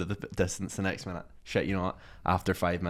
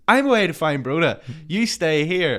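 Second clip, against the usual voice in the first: higher, louder, declarative orders; raised-voice retold instruction; acted bossy announcement; 7 semitones higher, 7 dB louder than usual. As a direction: imitate a friend taking charge. Thomas giving orders, acted.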